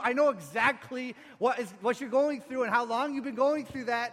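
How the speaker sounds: loud, high, impassioned reassurance; Jesus. Acted. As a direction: insist with full force that you understand. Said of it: "Listen to the voice of Jesus".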